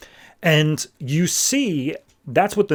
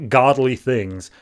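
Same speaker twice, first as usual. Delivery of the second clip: ordinary talk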